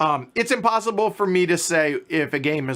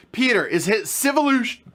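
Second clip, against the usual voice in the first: interrupted by hiccups; hiccuping mid-sentence; 7 semitones higher than usual. Real, not acted. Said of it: "I got the hiccups now".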